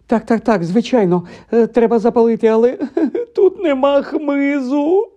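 high-pitched, whimpering wail; tearful panic. A narrator's reading. Hermione wringing her hands without firewood, crying. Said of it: "заплакала Герміона, заламуючи руки".

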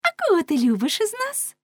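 high, lilting coy question; teasing woman. Acted. Women asking man whom he loves, high teasing lilt. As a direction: ask coyly in a high girlish voice.

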